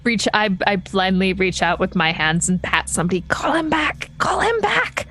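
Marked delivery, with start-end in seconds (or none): 3.2-4.1 high, urgent mock-panicked call; urgent plea. Player acts out urgent plea. 4.2-5.1 very high, panicky squeal of a plea; frantic plea. Acted. Repeats the plea, more frantic.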